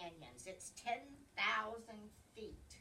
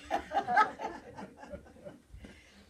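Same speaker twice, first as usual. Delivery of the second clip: laughing; 9 semitones higher, 11 dB louder than usual. Real, not acted. Laughs at her own accent.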